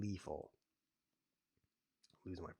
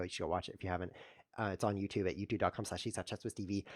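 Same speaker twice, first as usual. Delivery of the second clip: normal talking voice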